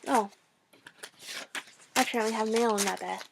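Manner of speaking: ordinary delivery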